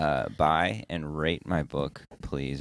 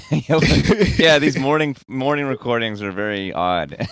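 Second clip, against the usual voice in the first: laughing; 8 semitones higher, 8 dB louder than usual. Real, not acted. Laughing through morning chatter.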